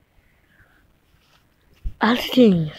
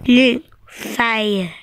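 usual speaking voice

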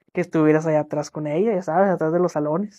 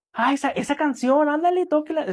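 mock pushy client; 9 semitones higher than usual. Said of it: "y haces esto".

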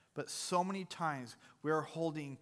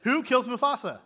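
louder repeat; 7 semitones higher, 11 dB louder than usual. Real, not acted. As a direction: repeat the question louder and higher.

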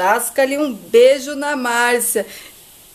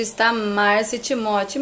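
usual speaking voice

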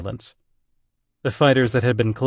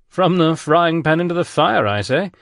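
quick dry British retort, higher and louder; English accent; 8 semitones higher, 5 dB louder than usual. Acted. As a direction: snap back like an unflappable English gentleman. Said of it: "distinguished English accent".

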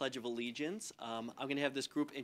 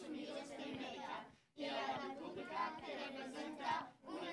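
teacher voice; 11 semitones higher than usual. Real, not acted. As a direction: lead the class in the pledge. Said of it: "I use my teacher voice".

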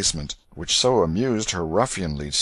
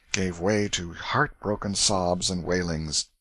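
ordinary delivery

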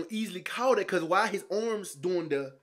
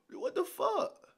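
shocked disbelief; 6 dB quieter, much slower than usual. Real, not acted. Gasps at the stat.

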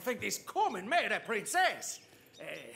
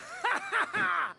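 loud swashbuckler's cackle; hearty theatrical ha-ha-ha; triumphant laugh; 5 semitones higher, 3 dB louder than usual. Acted. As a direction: laugh boldly mid-duel. Knight laughs amid sword flourishes.

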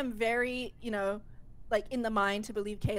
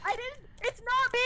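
desperate denial; 11 semitones higher than usual. Real, not acted. Accused imposter desperately denying.

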